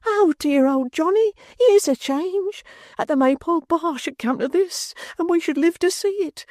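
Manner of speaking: a simple-hearted fellow crying over his ruined friend; tearful lament. A narrator's reading. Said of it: "cried outright and wrung his hands".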